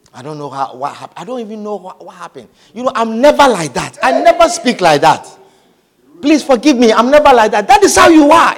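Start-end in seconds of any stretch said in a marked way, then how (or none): 2.7-7.7 mock pleading. Imitates someone begging forgiveness. 7.7-8.6 emphatic retort. Real, not acted. Preacher retorts.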